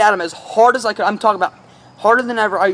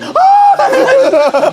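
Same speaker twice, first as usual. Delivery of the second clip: pained yelp; 8 dB louder than usual. Retold or imitated. Opponent knocked flying, cries out.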